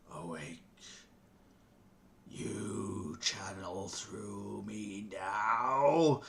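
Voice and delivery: slow, low, drowsy ancient deity; deep booming god voice. Acted. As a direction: yawn it out like a sleepy god. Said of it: "This b-, deep, booming voice, um, yawns and says".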